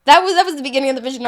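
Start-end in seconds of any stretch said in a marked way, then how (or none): 0.0-0.2 loud high laughing words. Real, not acted. Laughing at friend's great story comment, real laugh. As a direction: laugh through it.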